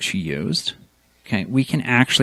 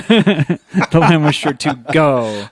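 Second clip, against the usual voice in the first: laughing through the rhyme's last line, slowly; slower than usual. Real, not acted. Reciting the rhyme to a co-host, laughing at a slip.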